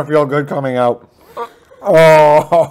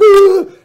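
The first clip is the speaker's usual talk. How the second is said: high panicked yelp; startled scream; 7 dB louder than usual. Retold or imitated. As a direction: scream like a zombie grabbed you. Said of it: "he was like".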